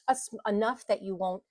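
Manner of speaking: normal talking voice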